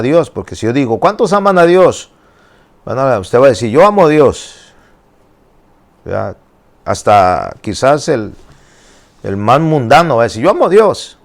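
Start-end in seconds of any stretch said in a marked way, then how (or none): 1.0-2.1 high, loud call to the crowd; rallying the congregation. Acted. Acts rallying question to congregation. 3.7-4.7 raised, louder voice of a believer answering; mock eager answer. Acts congregation's eager answer. 10.4-11.2 high, loud imitation of a worldly believer; mock eager declaration. Acts worldly person's eager answer.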